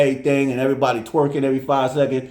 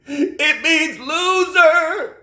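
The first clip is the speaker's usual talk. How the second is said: high, slow mock tearful voice; tearful girl; 14 semitones higher, 3 dB louder, much slower than usual. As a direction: say it slowly as a hurt little girl. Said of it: "And she said".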